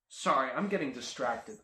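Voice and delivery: usual speaking voice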